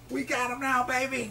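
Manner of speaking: put-on high old-lady voice, chuckling; old lady in the crowd cheering him on; big mama voice. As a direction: cheer it like a delighted granny. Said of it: "his voice".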